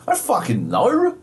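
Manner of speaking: high-pitched, hammed-up bafflement; escalated exasperation. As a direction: let the exasperation burst out in pitch. Repeated exasperated reply, escalated take.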